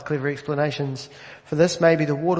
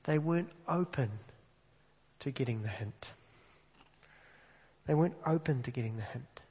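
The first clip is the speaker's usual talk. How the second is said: earnest quiet appeal; 7 dB quieter than usual. Real, not acted. Preacher softly challenging his congregation to be open. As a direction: lean in and ask them gently.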